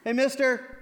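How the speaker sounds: a chipper runner tapping on the window; cheery jogger. Pretend. Jogger knocks on sleeping man's car.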